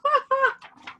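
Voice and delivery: high loud mocking laugh; crow cackle. A narrator's reading. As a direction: cackle at the scarecrow. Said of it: "make me laugh".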